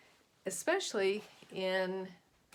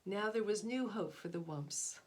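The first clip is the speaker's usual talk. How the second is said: gentle read-aloud storytelling voice; storybook narration. A narrator's reading. Teacher finishing a picture book for her class, storybook narration.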